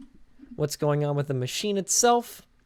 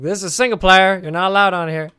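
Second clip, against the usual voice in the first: louder, warning an intruder off; mock warning shout; 5 semitones higher, 10 dB louder than usual. Player scared by a name in single player, mock warning shout. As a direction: yell at the stranger to get out. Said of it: "I'm like".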